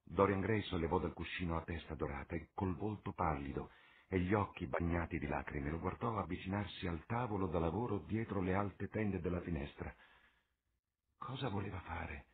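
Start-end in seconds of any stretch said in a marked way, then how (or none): none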